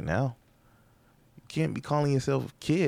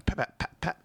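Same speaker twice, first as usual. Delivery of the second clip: high-pitched mock gunfire pops; gun noise; 9 semitones higher than usual. Shown as a demonstration. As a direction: spit out toy gun pops. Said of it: "bad impression of a gun going off".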